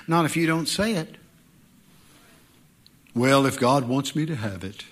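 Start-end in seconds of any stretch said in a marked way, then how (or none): 3.1-4.8 low, limp mock excuse; droning excuse. Preacher mocking a churchgoer who leaves everything to God, low limp drone. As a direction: shrug it off like a passive believer.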